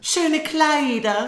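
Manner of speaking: high, slow, prissy stepsister voice; greedy stepsister. Acted. Stepsister asking father for dresses, high put-on voice.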